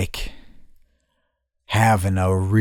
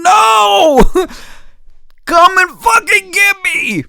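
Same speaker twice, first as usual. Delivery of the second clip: very high, loud mock wail; desperate inner scream; 4 dB louder, slower than usual. Screaming in his mind for rescue, desperate shriek. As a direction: scream the plea, dragging it out. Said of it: "But in my mind, I'm going".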